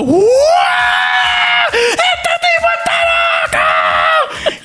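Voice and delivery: very high shouted uproar; class in uproar. Retold or imitated. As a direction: yell like a whole class going wild. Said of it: "aquel salón se ha vuelto". (gritos de la clase alborotada)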